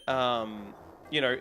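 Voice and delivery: normal talking voice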